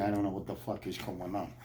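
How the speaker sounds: normal talking voice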